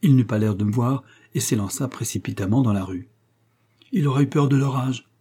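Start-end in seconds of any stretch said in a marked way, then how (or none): 3.9-5.1 a little higher, innocently guessing; a touch of naive wonder; naive thought. A narrator's reading. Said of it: "pensai-je ingénument". (pensée ingénue, un peu plus aiguë)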